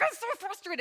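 high, whining I'm so frustrated; whiny complaint. Retold or imitated. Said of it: "I would just be like".

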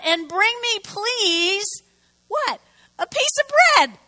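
preacher voicing Elijah's cheeky ask for bread, high and loud; high mock request. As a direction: sell the outrageous request for bread. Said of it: "he called out".

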